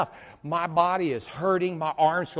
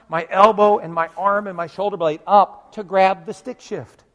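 normal talking voice

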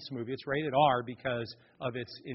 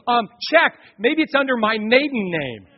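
desperate pleading; 8 semitones higher, 9 dB louder than usual. Retold or imitated. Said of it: "this lady goes".

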